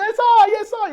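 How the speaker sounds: high, loud, overjoyed cry of "¡Es hoy!"; excited cartoon shout. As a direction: burst out with childlike joy. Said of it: "pasa por toda la casa diciendo".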